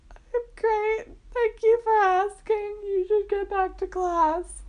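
high, strained voice through tears; tearful fake cheer. Telling the little girl she's fine, high tearful fake cheer. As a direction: claim you're great while crying.

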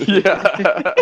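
laughing yeah, high and loud. Real, not acted. Laughing at disagreement.

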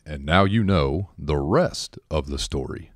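slow, deliberate radio sign-off; Paul Harvey. Retold or imitated. Recalling a radio host's famous ending, slow imitation. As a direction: lightly imitate a radio host's measured closing line. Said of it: "he would end it at the end saying".

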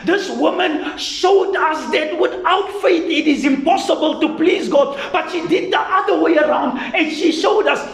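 full-force sermon delivery; fiery preaching. Acted. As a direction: preach it out to the congregation.

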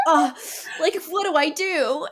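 high-pitched imitated exasperated groan; hammed-up "ugh", then high helpless question; mock exasperation. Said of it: "like, rolling her eyes".

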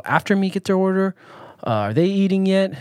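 worried glance at the next table's food; anxious inner voice. Retold or imitated.

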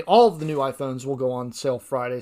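ordinary delivery

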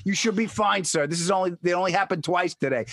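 calmly reassure, in contrast to the screamer; retold reassurance. Clinic woman to the panicked patient, retold reassurance.

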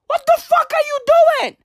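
high exasperated "What the fuck are you"; mock scolding. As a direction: scold him, voice jumping up. Scolding Neymar directly.

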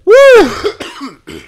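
loud whooping yell from the heat; high loud woo. Real, not acted. Reacting to spicy wing.

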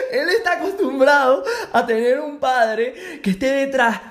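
high, amused laughter through words; laughing. Real, not acted. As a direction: crack up at the father idea. Laughs at own joke.

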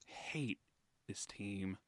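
barely saying it, defeated. Real, not acted. Defeated fan after bad news.

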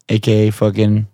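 ordinary talk, nothing marked

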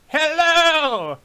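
high, loud calling voice. Acted. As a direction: call out from a hidden cage. Unseen prisoner calls out.

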